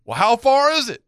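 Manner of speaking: high, loud retold question; clueless boater calling. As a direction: call it across as the lost boater.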